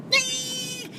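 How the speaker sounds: very high sung ding; a bell going off; cartoon ding. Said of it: "it's like".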